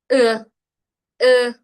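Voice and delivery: "E" as a loud, open, unrounded back vowel; a held Chinese e, not the Spanish e. Shown as a demonstration. Teacher drilling the Chinese e vowel loudly. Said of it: "no suena como una E en español".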